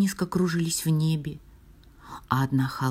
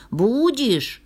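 high, threatening repeat; sharp raised storybook yell; angry shout; 7 semitones higher, 4 dB louder than usual. A narrator's reading. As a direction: demand it, raised and forceful. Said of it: "кричал он".

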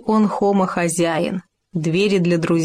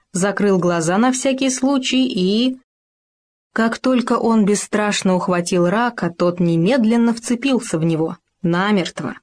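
usual speaking voice

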